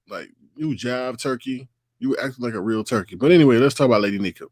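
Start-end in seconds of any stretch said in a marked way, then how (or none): none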